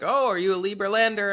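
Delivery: high, quick, eager voice of a shirtless Dutch guy; imitating Dutch guy. Dutch guy on a houseboat greets the narrator, high and eager. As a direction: pitch it up high and fast like a friendly stranger. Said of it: "he's like".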